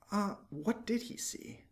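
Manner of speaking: slow, hushed storyteller question; suspenseful. A narrator's reading. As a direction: hold them in suspense, softly. Narrator builds suspense.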